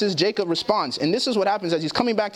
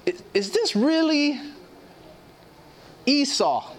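higher, unsure blind father, slowing on "really"; doubtful old Isaac; 6 semitones higher, slower than usual. Retold or imitated. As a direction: voice old Isaac hesitating, not convinced. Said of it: "He's saying".

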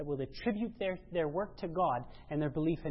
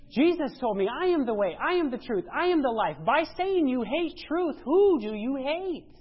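fiery preaching; 7 semitones higher, 7 dB louder than usual. Acted. Preacher driving truth home to congregation, full-force preaching. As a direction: hammer each I am home.

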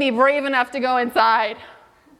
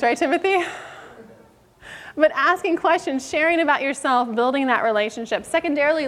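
ordinary delivery

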